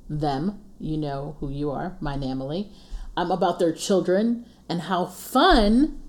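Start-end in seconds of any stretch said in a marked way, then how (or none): 4.8-6.0 dry sarcastic how fun. Acted. Teasing homeschooling mamas, sarcastic. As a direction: say it so everyone hears the opposite. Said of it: "I said that very sarcastically".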